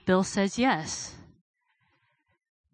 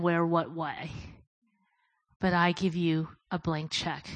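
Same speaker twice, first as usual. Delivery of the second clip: soft, yielding prayer of surrender; hushed surrender; 3 semitones lower, 5 dB quieter than usual. Retold or imitated. Heidi surrendering to God in her vision, soft and yielding. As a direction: give it all up quietly.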